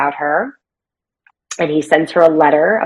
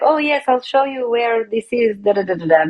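put-on Italian accent, pitched up at first; 5 semitones higher than usual. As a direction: play her with a mock Italian accent. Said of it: "in her Italian accent".

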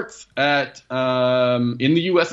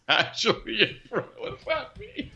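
laughing, much higher, incredulous; laughing disbelief; 13 semitones higher, 5 dB quieter than usual. Real, not acted. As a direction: giggle through the question. Laughing at cohost's odd phrase.

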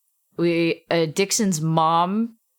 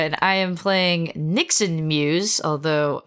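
ordinary talk

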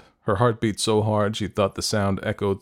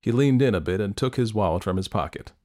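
ordinary delivery, nothing marked

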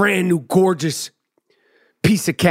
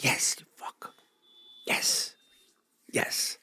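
muttered yes under the breath; whispered under breath; 13 dB quieter, slower than usual. Retold or imitated. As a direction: hiss it to yourself. Inner reaction to the guard.

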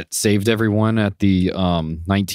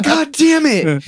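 high, loud mock outburst; squeaky incredulous lawyer impression; exasperated lawyer; 4 dB louder, slower than usual. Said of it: "He's like".

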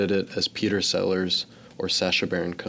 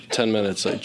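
ordinary delivery

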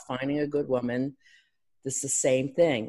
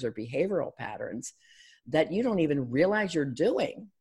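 normal talking voice

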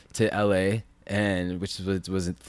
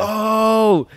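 high, loud "oh"; retold shock; 13 semitones higher, 12 dB louder than usual. Telling friends his reaction to the news, shocked cry.

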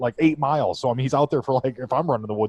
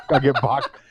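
normal talking voice

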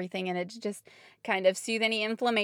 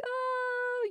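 crying wail; 5 dB quieter than usual. Retold or imitated. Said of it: "I was just kinda crying, kinda wailing".

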